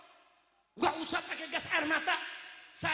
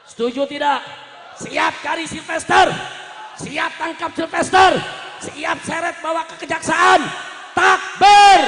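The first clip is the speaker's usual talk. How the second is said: full-force rally cry building to a peak; roaring call to a mass crowd; rallying shout; 4 semitones higher, 18 dB louder than usual. Real, not acted. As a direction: shout the crowd into action, higher and much louder.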